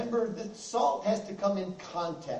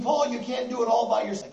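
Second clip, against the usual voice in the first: ordinary talk, nothing marked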